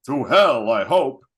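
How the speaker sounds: loud, punchy movie-quote delivery; emphatic imitation of a gruff guard's retort; mock scornful retort. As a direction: hit HELL hard, like a retold comeback. Acting out guard's scornful comeback.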